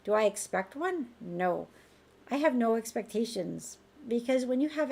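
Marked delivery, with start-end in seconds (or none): none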